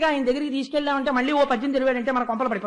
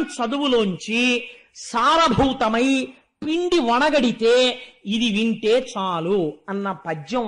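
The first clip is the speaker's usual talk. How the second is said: slow insistence; 4 dB louder, slower than usual. Acted. King demands the essential verse.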